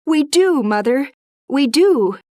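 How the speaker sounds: high, loud, eager agreement; the girls eagerly promise their mother; eager cry. Acted. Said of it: "cried the girls".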